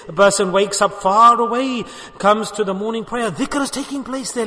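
ordinary delivery

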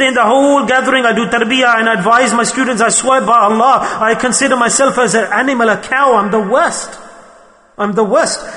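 impassioned retelling. Retold or imitated. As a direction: swear it emphatically, pitched high. Retells sheikh's impassioned oath of humility.

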